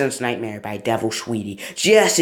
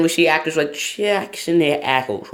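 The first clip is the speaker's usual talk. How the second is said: ordinary delivery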